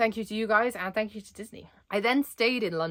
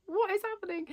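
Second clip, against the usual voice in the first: much higher, pitched up in disbelief; stunned disbelief; 12 semitones higher, 3 dB quieter than usual. Retold or imitated. Retelling meeting Thomas Schumacher, a high stunned squeak. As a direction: squeak it out like you can't believe it. Her own stunned reaction retold.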